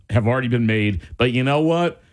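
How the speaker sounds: ordinary talk, nothing marked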